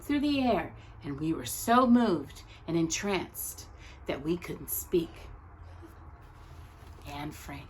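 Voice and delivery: measured, expressive picture-book reading; story narration. Acted. Teacher reading a picture book about Anne Frank's tree to her class, gentle storytelling. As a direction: read it like a storyteller at circle time.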